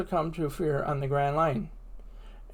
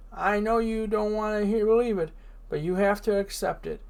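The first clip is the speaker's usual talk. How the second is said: usual speaking voice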